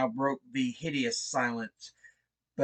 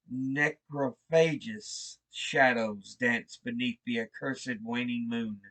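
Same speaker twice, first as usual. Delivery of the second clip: ordinary delivery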